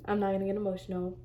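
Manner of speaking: swallowing the tears, barely audible; holding back tears. Real, not acted.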